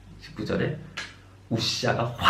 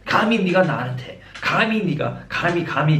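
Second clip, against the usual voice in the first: mock indignant; 5 semitones higher, 3 dB louder than usual. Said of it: "감히 그 소리가 왜 나오는지".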